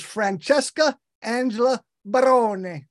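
Francesca Angela Barone said as Italian names; Italian pronunciation. Shown as a demonstration. Host reveals guest's original name Barone, Italian pronunciation. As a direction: give Barone three syllables, Italian style, ending in a sounded e. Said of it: "That's the Italian way to say it".

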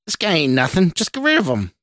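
high-pitched, heated goading; snappish. A narrator's reading. Stranger urges Scott against his boss, snapping. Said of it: "the stranger in the mirror snapped".

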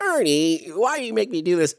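a bad Bert impression, whining up high. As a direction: Bert in a dress whining at Ernie. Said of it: "my impressions are terrible".

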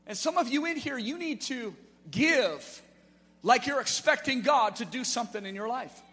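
loud, high impassioned preaching; rally the church to step out; preaching at full force. Real, not acted. Pastor urging the church to give, loud preaching.

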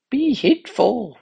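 put-on Be heedful, high, loud and slow from the young physician; young physician's warning. A narrator's reading. Physician warns the officer, high and urgent. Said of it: "whispered the young physician".

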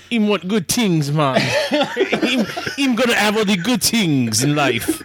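put-on Jamaican accent. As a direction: drop into a playful Jamaican accent. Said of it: "Why did you turn Jamaican?".

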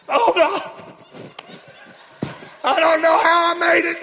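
high, loud strained wail; barely-made-it straggler. Acted. Straggler crying to Jesus at heaven's gate, desperate mock wail. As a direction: cry out as if hanging on desperately, hammed up. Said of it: "barely made it by the skin of my teeth people".

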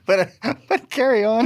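high voice breaking with laughter; amused, high-pitched and laughing. Real, not acted. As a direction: wave them on through giggles. Still laughing, waves co-host on.

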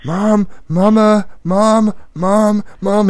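dad imitating his son tugging on mom; nagging toddler. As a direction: call for mom like a clingy toddler. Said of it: "and he's tugging on her".

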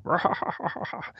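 high, breathy evil cackle imitating a cartoon baddie; hammed-up villain laugh, fading out; anime villain laugh. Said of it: "He's got a very anime villain laugh".

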